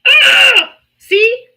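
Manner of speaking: high, loud yelp of despair; loud cry of frustration; frustrated scream. Real, not acted. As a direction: shriek at the phone, then point it out. Phone fails again.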